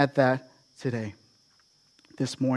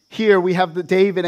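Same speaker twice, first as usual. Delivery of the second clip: usual speaking voice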